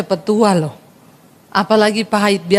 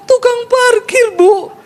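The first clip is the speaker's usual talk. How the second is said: mock whiny complaint; 11 semitones higher, 3 dB louder, slower than usual. Imagined person naming grudge.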